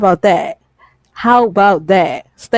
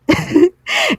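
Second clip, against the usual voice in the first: laugh; 10 semitones higher than usual. Real, not acted. Exasperated laugh mid-rant.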